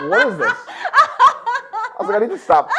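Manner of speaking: high laughing exclaim. Real, not acted. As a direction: laugh at yourself. Laughing at self.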